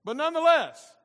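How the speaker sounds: loud, high, slow preacher's proclamation; full-force preaching. Acted. Pastor expounds the creation verse to his congregation, preaching at full force. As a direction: preach it out, drawing out the key words.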